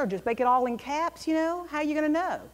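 ordinary talk, nothing marked

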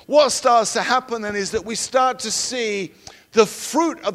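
fervent, loud sermon delivery; preaching. Acted. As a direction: preach it with full conviction. Preaching at full force.

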